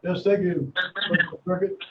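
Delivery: echoey, distant phone line; sounding like you're down a well; inside a well. Real, not acted. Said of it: "You sound like you're in a well".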